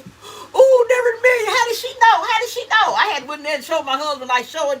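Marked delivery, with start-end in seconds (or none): 0.0-2.9 high, loud delighted squeal; excited squeal. Retold or imitated. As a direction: gush like opening a surprise. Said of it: "I was like".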